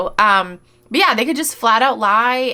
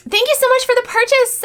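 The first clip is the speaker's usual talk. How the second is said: much higher, sugary customer-service voice; mock sweet seller voice; 4 dB louder than usual. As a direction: parody a fake-nice shop seller. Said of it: "okay, I'm not gonna do that".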